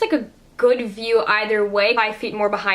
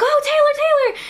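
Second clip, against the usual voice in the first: mock fangirl squeal, much higher and a bit louder; screaming fans; 4 dB louder than usual. Imitating a crowd of fans calling to [name], high mock squeal. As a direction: squeal her name like a starstruck crowd. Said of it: "a crowd of fans being like".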